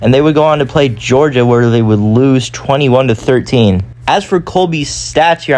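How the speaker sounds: ordinary delivery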